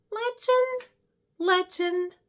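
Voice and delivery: very high, gushing legend; swooning. Acted. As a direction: swoon over your hero. Swooning over the heroine.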